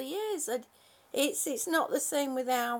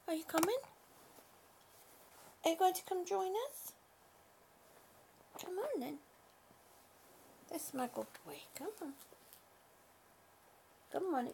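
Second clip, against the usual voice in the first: coaxing pet talk; 4 semitones higher, 7 dB quieter, faster than usual. Real, not acted. Coaxing her cat to come.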